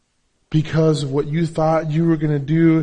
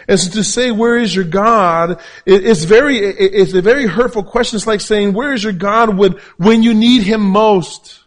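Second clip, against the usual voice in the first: high, loud, emphatic sermon delivery; full-force preaching; 6 semitones higher, 9 dB louder than usual. Real, not acted. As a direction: preach it hard, pitch raised and voice projected.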